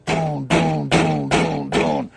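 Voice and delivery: low, dull doon knocking sounds; low lazy knock noises; imitated weak knocking. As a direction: mimic a feeble knock on the door.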